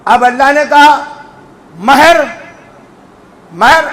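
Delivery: usual speaking voice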